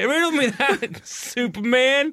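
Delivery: high, whining mock Lex; whiny high villain. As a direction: whine like a petulant villain. Imitating Lex, high pitched.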